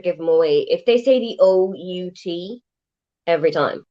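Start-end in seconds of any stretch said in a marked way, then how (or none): none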